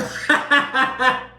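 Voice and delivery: high-pitched burst of laughter. Real, not acted. Podcaster cracking up at the rampage quote, high laugh.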